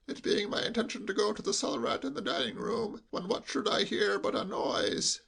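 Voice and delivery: hushed, high sheep-like bleat. Acted. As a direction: bleat it confidentially, as through cotton wool. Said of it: "in a lowered voice".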